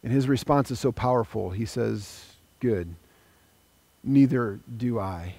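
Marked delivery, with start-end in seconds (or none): 2.6-5.3 gentle forgiveness. Acted. Jesus forgives in retold story.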